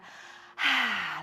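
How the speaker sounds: long audible sigh out through the mouth; demo sigh. Shown as a demonstration. Teacher showing the class how to sigh the breath out. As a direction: let the breath fall out in one big sigh. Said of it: "like a sigh".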